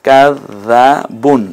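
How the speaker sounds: কাজ্জাবুন drawn out in separate syllables; slow demonstration. Shown as a demonstration. Teacher demonstrating tashdid and tanween, slow syllables. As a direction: stretch কাজ্জাবুন very slowly, piece by piece. Teacher repeats it slowly in pieces.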